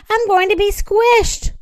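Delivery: high, alarmed cry; shouted in alarm. A narrator's reading. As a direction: wail it high like a boy in danger. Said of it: "he shouted".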